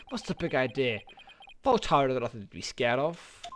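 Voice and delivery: normal talking voice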